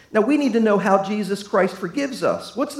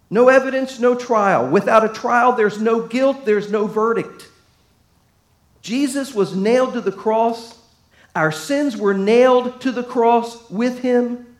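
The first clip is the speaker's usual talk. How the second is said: raised, louder preaching voice; 4 semitones higher, 5 dB louder than usual. Acted. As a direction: preach it hard to the congregation. Preaching builds about the cross.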